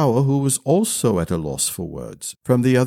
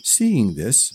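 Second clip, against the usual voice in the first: ordinary delivery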